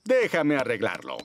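usual speaking voice

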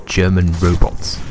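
ordinary delivery

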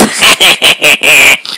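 a shrill cackling hyena laugh. Acted. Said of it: "And he laughs like a hyena too".

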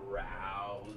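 low and soft brown; low quiet answer. Pretend. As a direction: drop the voice down low. Kid repeats the colour answer.

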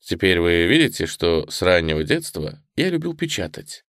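ordinary delivery

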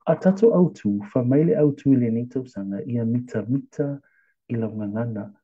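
ordinary talk, nothing marked

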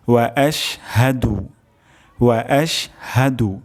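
ওয়া আশহাদু slowly, lips round then spread on ওয়া, clear শ; slow careful recitation. Shown as a demonstration. Teacher models the correct words for students, slow and careful. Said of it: "ঠোঁট গোল হবে".

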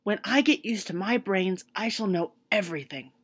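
a hopeful scarecrow announcing good news. A narrator's reading. Scarecrow continues.

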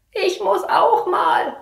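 put-on slow, high, strained little boy; child, choked voice. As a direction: plead like a desperate kid. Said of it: "ruft er mit belegter Stimme". (gepresste Kinderstimme)

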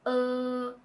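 Uh held relaxed, mouth neutral; neutral uh vowel. Shown as a demonstration. Tutor models the vowel, relaxed. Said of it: "your mouth is in a very neutral, relaxing position".

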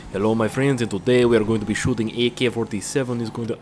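shaky attempt at a Russian accent; Russian host impression, given up halfway; FPS Russia. Gamer retrying the Russian host for viewers, rough Russian accent. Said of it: "I can't do his voice too well".

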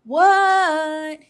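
loud answering yell; yelling back. A narrator's reading. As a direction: bellow back from outside. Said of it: "yelled Froggy".

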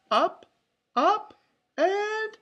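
high, loud, punchy calls; excited countdown. A narrator's reading. Launching the balloon with friends, excited calls. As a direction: call each word out high and eager. Characters calling lift-off.

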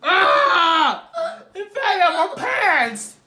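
high laughing yelp; laughing shout. Real, not acted. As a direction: cry out through real laughter. Pasta falls, laughing outburst.

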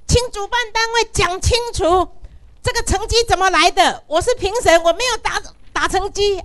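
高声激动地质问主办单位; loud, high, indignant protest; retold angry demand. Retelling her angry demand to organizers. (激动大声质问)

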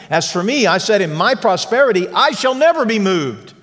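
high, proud boasting, "never" thrown up high; arrogant boast. Retold or imitated. Pastor voicing David's arrogant boast to the church, high and proud. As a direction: crow it like a smug, proud king. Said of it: "the attitude of an arrogant man".

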